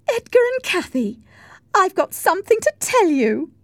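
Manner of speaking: put-on very high, loud girlish voice; excited announcement. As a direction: burst out with big news. Isabella makes sudden announcement.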